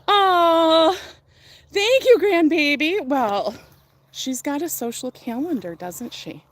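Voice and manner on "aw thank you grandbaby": cooing. Real, not acted. Cooing at her dog.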